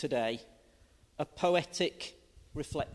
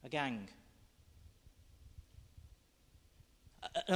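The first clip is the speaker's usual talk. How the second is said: poem reading. Acted. Reads poem aloud.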